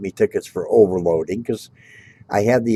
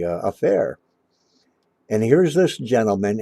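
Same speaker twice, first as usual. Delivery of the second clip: ordinary talk, nothing marked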